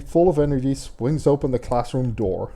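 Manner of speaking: ordinary talk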